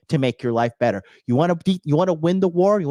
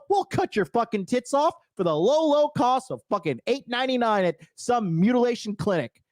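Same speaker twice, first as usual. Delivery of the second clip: mock enthusiasm; 8 semitones higher, 3 dB quieter than usual. Sarcastic mock voice.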